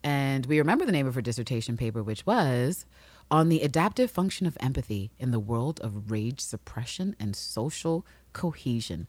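ordinary talk, nothing marked

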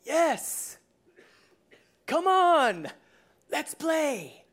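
high, game-on call; playfully acted eager invitation; Jesus. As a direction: call him out to join in. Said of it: "Jesus answers Peter and says".

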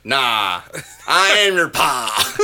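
hammed-up Southern villain reveal; Southern Vader. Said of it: "filmed instead in the Deep South".

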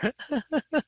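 a quick, high burst of laughter; short high laugh. Real, not acted. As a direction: laugh briefly at yourself. Laughs at own story.

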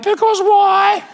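put-on high, slow whiny child asking why; toddler voice. Dad imitating his son's whiny question. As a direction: mimic a small boy's drawn-out question. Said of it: "Archer quote".

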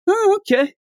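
put-on high, light innocent boy voice; a sweet naive boy shrugging it off; naive Goku. Goku easily agreeing, in a high naive voice. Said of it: "he always is like".

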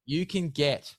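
hyped ad read. Acted. Ad read of code.

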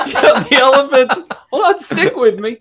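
high, loud, breaking into laughter; laughing while telling. Real, not acted. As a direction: fight through the giggles to keep telling the joke. Teller cracking up mid-joke.

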